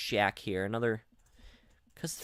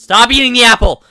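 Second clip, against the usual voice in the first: high, loud exasperated shout; exasperated yell; 10 semitones higher, 19 dB louder than usual. Real, not acted. Gamer yelling again at someone eating the apple, exasperated. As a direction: scream it louder in frustration.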